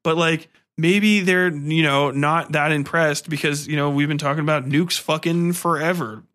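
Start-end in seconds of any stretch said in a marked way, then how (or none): none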